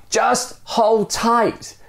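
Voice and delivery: very high, slow drawn-out mock yell; a high, slow yell from the man dangling below; storytelling yell. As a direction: stretch it out as a high call upward. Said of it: "the stranger yells".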